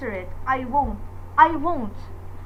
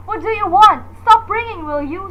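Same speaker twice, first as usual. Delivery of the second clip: shouting; 5 semitones higher, 7 dB louder than usual. Acted. Shouting at the caller.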